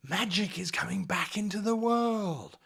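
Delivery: whispered reveal; dramatic whisper. Acted. Revealing the campaign twist to the players in a whisper. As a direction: breathe the secret out.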